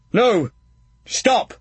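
loud, high shout of alarm; yelling to stop an outrage. Acted. Kent in the stocks shouting at Drool, loud alarm. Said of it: "shouted Kent".

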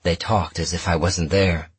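ordinary delivery

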